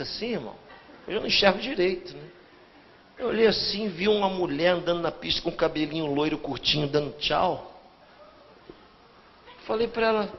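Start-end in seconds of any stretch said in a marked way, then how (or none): none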